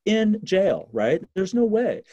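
high, loud, fired-up argument; impassioned. Real, not acted. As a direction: press the point hard with indignation. Passionate point about privilege.

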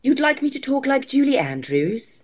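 high-pitched posh English accent; theatrical posh English demo. Shown as a demonstration. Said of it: "Can we hear the posh accent?".

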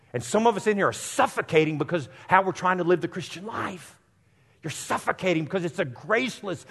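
loud, high impassioned preacher; fervent sermon climax; preaching at full force. Real, not acted. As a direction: preach it hard to the pews.

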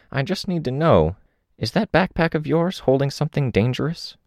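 a lightly voiced reflective old woman. Old woman speaking in story.

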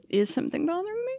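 high, quick, taken aback; surprised. Retold or imitated. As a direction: genuinely caught off guard. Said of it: "you have to go".

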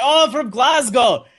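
high, slow put-on Scottish accent. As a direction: proudly fake being a Glaswegian, high-pitched Scottish accent. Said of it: "one more time with the Scottish accent".

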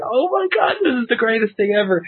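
retold ecstatic fanboy gasp; overjoyed fan. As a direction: gush like the best day of your life. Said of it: "I was like".

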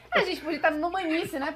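talking through a laugh. Real, not acted. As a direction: laugh it out while speaking. Laughing at co-host's joke. (rindo enquanto fala)

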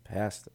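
"Pasta" echoed softly with the A of "past"; Canadian pronunciation. Retold or imitated. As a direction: quietly repeat "Pasta" with a Canadian short A. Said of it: "he pronounces pasta pasta".

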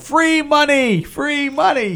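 high, drawn-out hawker's call; imitated yell. Re-enacting yelling outside a farmers market tent, high stretched mock yell. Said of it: "I s-, just yelled".

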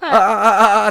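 high, loud laughing stammer; deliberate stutter. Shown as a demonstration. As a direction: stammer to lead the target. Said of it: "ってこうね、ずらしていくんよ".

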